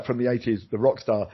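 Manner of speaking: ordinary talk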